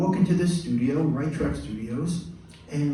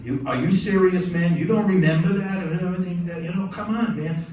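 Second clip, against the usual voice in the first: higher, louder mock disbelief; incredulous protest; 5 semitones higher, 4 dB louder than usual. Singer challenges forgetful composer, incredulous imitation. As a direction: push back loudly, pitched up.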